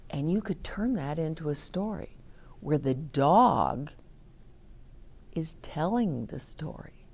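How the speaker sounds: unvoiced whisper to the class. Real, not acted. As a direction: lean in and whisper the idea like a secret. Teacher whispers an idea to class.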